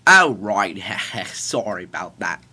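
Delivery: loud British "oh, right", with a laugh; bumbling British apology; bad British accent. Father apologizes to his son, bad British accent. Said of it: "I'm doing a British accent".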